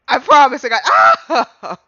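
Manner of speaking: loud, laughing through the words; breaking into laughter. Real, not acted. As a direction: lose it mid-sentence. Breaks down laughing while reading.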